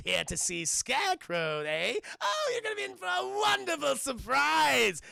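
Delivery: high, loud manic villain with a theatrical taunt; Joker impression. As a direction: tease Batman like a gleeful madman, savouring every word. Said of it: "a really good Hamill Joker".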